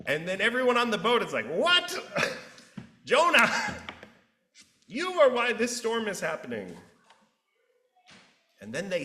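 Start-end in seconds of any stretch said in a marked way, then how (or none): 1.5-6.8 shouted, high-pitched disbelief with a laugh; incredulous crowd outcry. Retold or imitated. Sailors round on Jonah, shouting and laughing in disbelief. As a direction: blurt it out, then point the finger.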